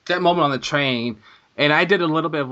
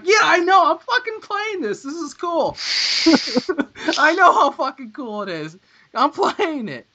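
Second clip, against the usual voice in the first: much higher, laughing exasperated gushing; exasperated excitement; 13 semitones higher, 3 dB louder than usual. Retold or imitated. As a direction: gush back at him, half laughing. Mocking own reply to brother.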